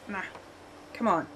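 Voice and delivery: normal talking voice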